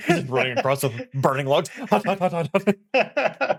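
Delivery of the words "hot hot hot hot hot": quick, high repeated yelps; playful pretend pain, pitched up; mock hot-footing. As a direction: hop across burning coals as you say it. Imitates running across burning logs.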